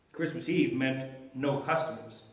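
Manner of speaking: ordinary delivery, nothing marked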